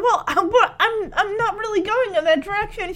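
high, flustered, laughing; laughing hesitantly. Retold or imitated. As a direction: stammer through a laugh. Voicing hesitant driver, laughing.

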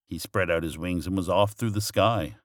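ordinary delivery, nothing marked

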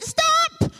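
sharp high shout of stop; quick shout. Retold or imitated. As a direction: call out to stop a child. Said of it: "they need a quick shout".

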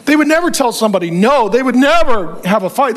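usual speaking voice

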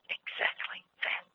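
soft whispered reveal; hushed punchline. Acted. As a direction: drop to a secret whisper.

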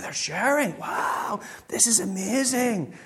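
hushed, high-pitched wonder; softly awestruck parent; mock amazement. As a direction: marvel as if at a miracle. Parents marvel at children sharing.

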